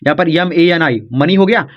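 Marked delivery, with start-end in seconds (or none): none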